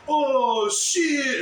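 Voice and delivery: high, loud "Oh, shit"; mocking shocked yell. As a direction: parody the community's reaction. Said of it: "all I get from the whole community is".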